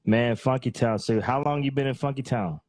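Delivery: ordinary talk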